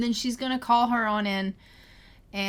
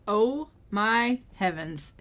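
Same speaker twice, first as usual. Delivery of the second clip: ordinary talk, nothing marked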